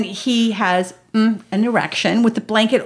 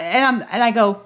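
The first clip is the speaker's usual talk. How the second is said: ordinary talk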